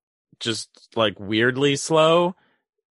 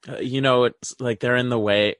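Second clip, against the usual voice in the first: usual speaking voice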